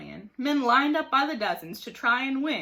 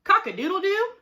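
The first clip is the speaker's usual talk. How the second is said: crowed like a rooster, much higher and louder; a proud rooster call, high and loud; 9 semitones higher, 6 dB louder than usual. A narrator's reading. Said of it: "cried Mike".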